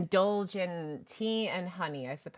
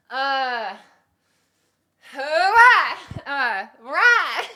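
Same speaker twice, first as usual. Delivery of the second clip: much louder, higher cheering yell, giggle creeping in; battle cry; 5 semitones higher, 11 dB louder, much slower than usual. Shown as a demonstration. Streamer rehearsing a battle yell for a voice line, loud cheer. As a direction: throw a rallying cry, then crack up. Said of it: "why does that rah just sound so kiddish".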